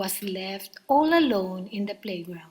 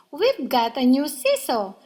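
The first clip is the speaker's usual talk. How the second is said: excited; 4 semitones higher, 7 dB louder than usual. A narrator's reading. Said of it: "shouting with excitement".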